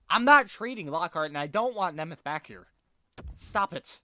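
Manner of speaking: loud, high, furious yelling at the game; furious shout. Real, not acted. Gamer raging over injuries, trade block.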